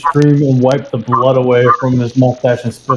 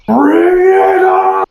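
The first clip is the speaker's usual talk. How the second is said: high, long drawn-out roar; bellowed challenge; much slower than usual. Acted. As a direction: shout it as a drawn-out challenge to a fight. Creature bellows challenge before belly slam.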